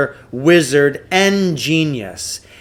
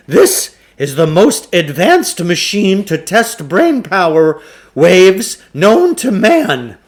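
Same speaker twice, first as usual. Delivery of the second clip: grand huckster; 6 semitones higher, 6 dB louder than usual. A narrator's reading. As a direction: announce it like a fairground pitchman. Klugarsh voiced in story.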